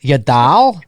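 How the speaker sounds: imitating a Chicago accent. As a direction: lean into the Chicago sound. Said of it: "Such a Chicago accent".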